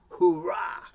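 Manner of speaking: loud, high burst of hoorah; rallying cheer. Pretend. Host cheering on marathon trainers, shouted rallying cheer.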